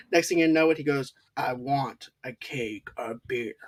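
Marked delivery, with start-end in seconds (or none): 1.4-3.5 low, slow, soft menacing creature voice; werewolf voice. Retold or imitated. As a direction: drop it down and drag it out like a beast. Werewolf demands keg, eyes glowing.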